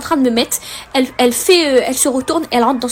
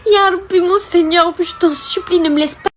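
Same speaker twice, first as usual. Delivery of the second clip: crying prayer; 4 semitones higher than usual. Retold or imitated. Alone in her car, begging God with a tearful voice. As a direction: beg God through tears. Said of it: "Non mais écoutez bien".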